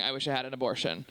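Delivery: low, quick deadpan grumble; mother's weary thought. Acted. Comic imagining a fed-up mother with her child, deadpan. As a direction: drop the voice for a bleak aside.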